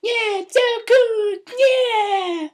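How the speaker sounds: put-on very high, loud squealing boy; excited kid. Little boy reading his Kindle, very high loud shriek. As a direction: shriek with a kid's delight. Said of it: "he's like".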